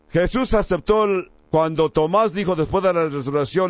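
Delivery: usual speaking voice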